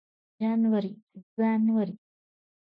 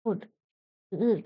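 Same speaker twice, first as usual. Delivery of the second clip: normal talking voice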